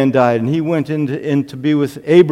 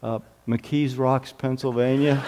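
subdued puzzled reply; 4 semitones lower, 9 dB quieter than usual. Retold or imitated. Visitor singled out in church answering the pastor, subdued.

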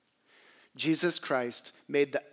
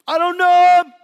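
much higher, much louder denial; panicked shout; 19 dB louder than usual. Acted. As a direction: shout it out in fear.

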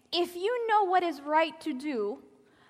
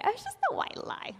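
grandmother voice; 9 semitones higher, 3 dB quieter, faster than usual. Retold or imitated. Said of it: "my grandmother used to say".

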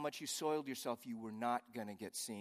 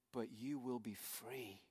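slow, soft reassuring promise; gently, as God comforting a struggling man; tender voice of God; 5 dB quieter, slower than usual. Acted. God speaking tenderly to believer.

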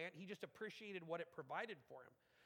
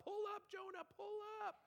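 much higher, shouted warning; urgent warning call. Acted. Preacher shouting at Jonah to pull up, urgent. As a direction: yell a warning from the sidelines.

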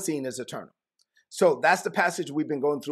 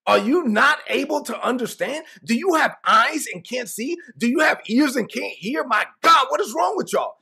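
frustrated Jesus; 6 semitones higher, 4 dB louder than usual. Retold or imitated. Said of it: "It's like".

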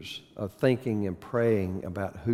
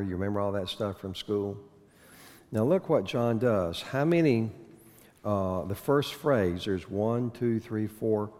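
ordinary delivery

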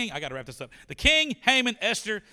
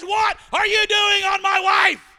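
high, loud, slow furious yell; the king bellowing in outrage; outraged shout; 13 dB louder, slower than usual. Acted. The king catching Haman on Esther, a furious shout.

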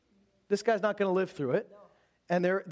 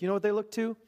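ordinary delivery

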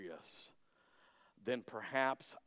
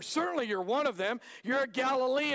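accusing; 10 semitones higher, 6 dB louder than usual. Acted. Bystanders accusing Peter.

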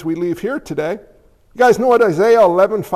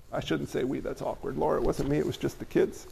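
sheepish aside; 7 semitones lower, 14 dB quieter than usual. Real, not acted. Sheepish aside to wife Laura.

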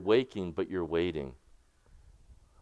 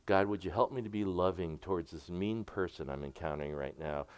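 much softer, a quiet private plea to God; hushed prayer; 6 semitones lower, 9 dB quieter than usual. Shown as a demonstration. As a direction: pray it under your breath, softer and lower. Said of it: "These are whispering prayers".

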